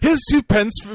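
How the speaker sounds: high, loud mock Londoner through laughter; laughing, hammed-up London tipper. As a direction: play a cheeky London customer, cracking up. Mimics tipping the London butcher.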